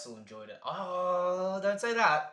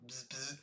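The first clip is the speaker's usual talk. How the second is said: radio buzz; 3 semitones lower, 15 dB quieter than usual. Retold or imitated. Imitates radio static.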